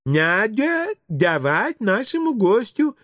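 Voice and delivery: high, slow, lisping coo; smiling teacher correcting. A narrator's reading. Teacher gently correcting her pupils, slow high cooing. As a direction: coo it slowly with babyish R's. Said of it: "с улыбкой поправила воспитательница".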